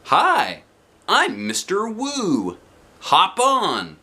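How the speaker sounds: high, slow, cheery driver greeting; bus driver voice. A narrator's reading. Bus driver greeting the kindergartner at the door, high and slow. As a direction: stretch out a friendly hello. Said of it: "the bus driver said".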